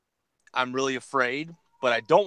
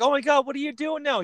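high-pitched, chatty mock delight; acted eager small talk, high; excited catching up. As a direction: gush at an old school friend. Acting out chatty catch-up mid-robbery.

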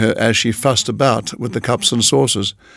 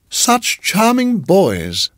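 high, drawn-out cooing; sweet landlady lingering over her praise; gushing landlady; 8 semitones higher, much slower than usual. A narrator's reading. Landlady answers from behind.

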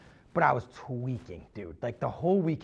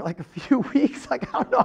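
nervous laughter; 9 semitones higher, 5 dB louder than usual. Retold or imitated. Telling how he answered the scary text, laughing nervously. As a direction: giggle it out nervously.

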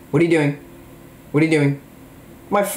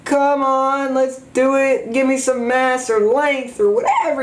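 a higher, lightly voiced YouTuber impression; PewDiePie; 10 semitones higher than usual. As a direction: keep the squeaky-ish youtuber bit going. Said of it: "I can even do PewDiePie".

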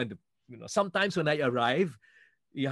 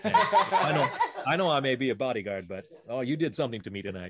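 put-on American bodyguard accent; 4 dB louder than usual. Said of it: "Yeah, brilliant accent".

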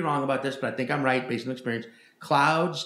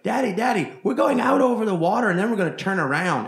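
higher, louder mock little kid calling out; eager kid; 8 semitones higher, 6 dB louder than usual. Kid on a plane tells his father the route, high and eager. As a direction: play the know-it-all kid announcing the flight path. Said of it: "the kid started being like".

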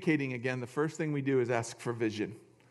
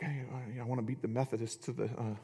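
mock impatient aside; 5 semitones lower, 7 dB quieter than usual. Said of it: "so you guys are like".

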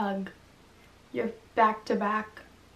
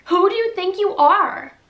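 higher and louder, furious; angry shout; 8 semitones higher, 8 dB louder than usual. Acted. Girl shouts at the intruder, furious. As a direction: shout him down.

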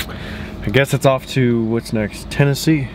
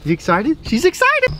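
excited dog talk; 7 semitones higher than usual. Real, not acted. Owner asking the dog if she's excited, excited dog talk. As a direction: pitch climbing into a high squeal.

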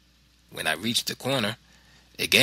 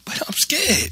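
very high frightened wail; terrified boy; 3 dB louder than usual. A narrator's reading. Boy told to fight the gang, high frightened wail. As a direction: whine it like a scared child.

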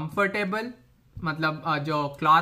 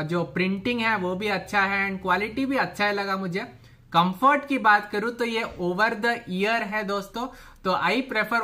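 talking through a cloth mask. Real, not acted. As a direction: voice muffled by fabric mask. Seen 0: Mask worn from 0.9s; unmasked at 0-0.7s; possibly B-roll over narration.

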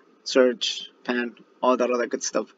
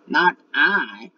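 duck refusing; 5 semitones higher, 5 dB louder than usual. Acted. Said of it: "quacked the duck".

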